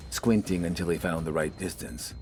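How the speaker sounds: ordinary delivery, nothing marked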